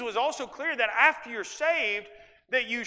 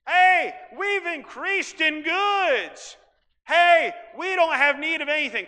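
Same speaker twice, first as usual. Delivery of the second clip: loud, much higher smug bragging; mock boast; 7 semitones higher, 8 dB louder, slower than usual. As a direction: shout the brag like a spoiled rich church. Mocking boastful lukewarm church.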